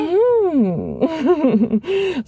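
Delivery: short high giggle through the mm; a sly, knowing chuckle; amused laugh. Acted. Laughing at the partner's fake cheating story, amused giggle.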